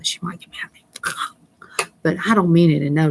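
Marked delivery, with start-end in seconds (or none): 0.0-0.8 hushed secret whisper. Real, not acted. Teasing about the man's wife, whispered secret. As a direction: whisper so his wife won't hear.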